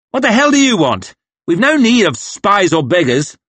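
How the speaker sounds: high, loud contemptuous snap; scornful officer. Acted. Officer rebuffing Three Trees, loud and contemptuous. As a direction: look down on them and bark.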